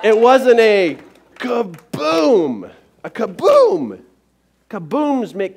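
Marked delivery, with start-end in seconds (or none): 0.0-4.0 loud, slow, high "kaboom" blast; kaboom effect. Shown as a demonstration. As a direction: boom it out like an explosion. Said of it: "These are sound effects".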